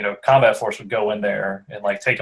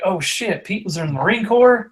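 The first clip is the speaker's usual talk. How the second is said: surprised; 9 semitones higher than usual. Retold or imitated. Coworker shocked at news.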